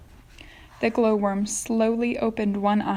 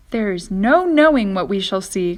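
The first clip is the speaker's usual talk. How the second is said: louder, eager call; excited cry; 8 dB louder than usual. Acted. Centipede answering the ladybug, loud eager cry. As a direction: cry it out with relish. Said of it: "cried the centipede".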